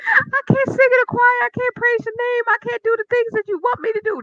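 hammed-up plea to the Lord; mock pleading prayer. Pleading with God, acted.

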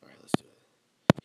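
hushed whisper. Real, not acted. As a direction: whisper it so nobody hears. Whispering before the call.

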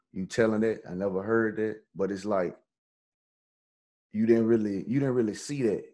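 normal talking voice

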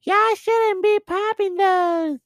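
high, loud, slow nagging woman voice; mock neighbor lady. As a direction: squeak it like a whiny neighbor. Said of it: "Like, (neighbor quoted)".

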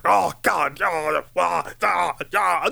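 imitating an upset movie Abraham; weird anguished wailing. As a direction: groan and wail to God, hammed-up. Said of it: "this is kinda how he sounded".

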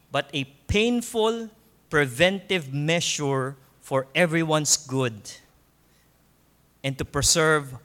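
slow, measured restatement with clear pauses. Real, not acted. Preacher repeating the key point so listeners get it, very slowly. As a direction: repeat it slowly, one phrase at a time. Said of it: "I'll say this very slowly so you will get it".

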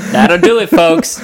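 movie line quote. Acted. Husband quoting the film line, loud mock sign-off. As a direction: deliver like a farmer's proud line.

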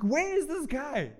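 high-pitched, acted panic; freaking out. Disciples hunting for Jesus in the storm, panicked. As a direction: demand it in alarm, voice pushed up. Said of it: "they're freaking out because they're gonna".

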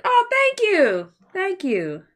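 high, slow gushing thanks; sweet drawn-out thank you; touched thanks. Real, not acted. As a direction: gush over the compliment. Touched by viewer compliment.

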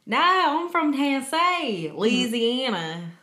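loud, slow, high Southern drawl; thick Southern accent. Pretend. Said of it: "hit 'em up with a thick-ass Southern accent".

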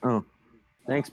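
usual speaking voice

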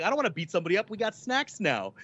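put-on gleeful kid who just got snacks; mock excited friend in the back seat. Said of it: "He's like".

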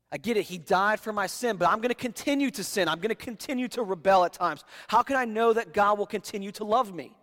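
loud, high, pressing doubter's questions; voicing the doubter. Preacher voicing a congregant's doubt about God's love, loud and high. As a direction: push the worried questions out hard.

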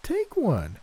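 put-on high old woman command; sweetly insist, with an edge; high firm granny. A narrator's reading. Gran voiced, commanding.

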